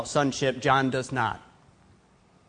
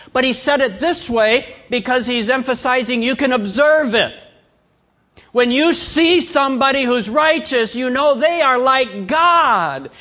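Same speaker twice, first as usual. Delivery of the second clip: high, loud, emphatic pulpit delivery; full-force preaching; 9 semitones higher, 8 dB louder than usual. Real, not acted. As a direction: drive the point home to the whole congregation.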